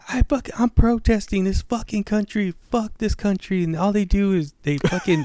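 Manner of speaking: high-pitched mock protest rant; imitated ranting lady. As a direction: rant as the outraged shoplifter. Lady's rant acted in story.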